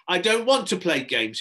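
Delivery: put-on loud, high boyish complaint; crying out in protest. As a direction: protest loudly like a cross child. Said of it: "he cried".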